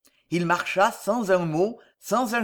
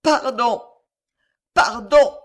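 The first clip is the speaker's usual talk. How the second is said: anguished cry for forgiveness, loud and high; anguished plea; 5 semitones higher, 6 dB louder, slower than usual. A narrator's reading. François prostrate before Jeanne, anguished cry for pardon.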